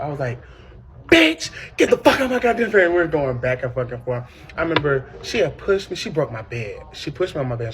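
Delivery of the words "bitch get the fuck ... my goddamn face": loud, furious snap; snapping. Retold or imitated. Teen snapping at her mother in the fight. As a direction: let it burst out of you. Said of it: "I just snapped".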